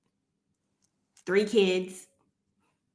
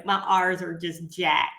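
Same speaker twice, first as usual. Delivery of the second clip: usual speaking voice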